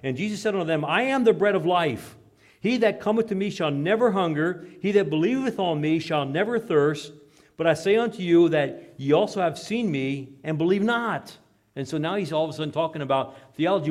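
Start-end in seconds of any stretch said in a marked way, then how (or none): 0.0-11.4 loud, high preaching read; ringing King James reading; scripture reading. Acted. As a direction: proclaim the verses to the congregation.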